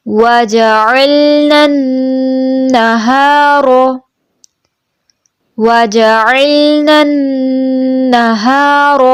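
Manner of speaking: slow melodic tajweed chant; Quran recitation. Shown as a demonstration. Teacher modelling the verse for the student in slow chant. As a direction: recite slowly, drawing out each vowel.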